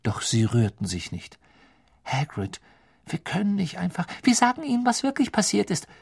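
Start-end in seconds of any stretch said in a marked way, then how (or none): 2.0-5.9 höhere, drängende Mädchenstimme; Hermine. A narrator's reading. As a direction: fleh Hagrid an, höher. (Hermine bittet Hagrid drängend, mit hoher Stimme)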